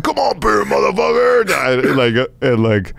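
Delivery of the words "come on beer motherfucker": high mock shout of a movie bully; hammed-up high shouted dare; Cobra Kai taunt. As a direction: bark it like a karate-movie villain. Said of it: "Cobra Kai dojo'd the fucking".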